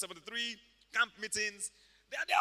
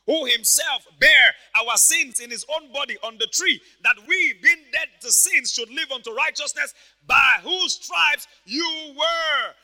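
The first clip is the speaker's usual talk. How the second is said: loud, high scripture declamation; full-force preaching; 6 semitones higher, 16 dB louder, slower than usual. Acted. Preacher quoting Second Peter to congregation, full-force preaching. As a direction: proclaim the verse slowly at full volume.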